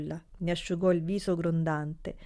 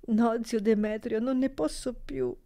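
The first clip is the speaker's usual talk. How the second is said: put-on high, broken plea of a crying girl; cling to him and cry it out; tearful exclamation; 4 semitones higher than usual. A narrator's reading. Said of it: "esclamò aggrappandosi ... lagrimosa". (supplica in lacrime della nipote)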